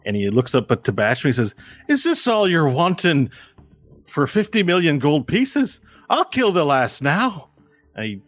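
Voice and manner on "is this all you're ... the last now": high-pitched, cocky small man; scrawny little thug. Acted. Scrawny man offers a kill to the boss, high cocky voice. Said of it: "scrawny-looking man".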